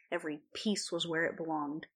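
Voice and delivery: plain reading voice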